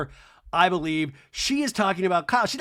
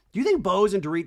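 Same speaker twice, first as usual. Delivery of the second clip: ordinary talk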